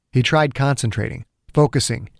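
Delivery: ordinary delivery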